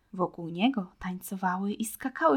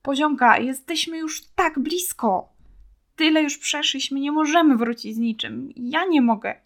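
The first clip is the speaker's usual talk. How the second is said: a hint of tearful begging, louder and higher; tearful pleading; 6 semitones higher, 6 dB louder than usual. A narrator's reading. Boy begs his friend to keep going, mildly tearful and loud. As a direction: plead with her through understated tears. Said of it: "po jego policzkach płyną łzy".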